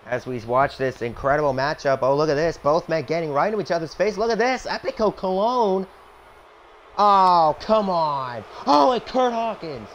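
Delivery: lively play-by-play announcer; wrestling commentator. Acted. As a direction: call the match like a TV announcer. Plays wrestling announcer over game.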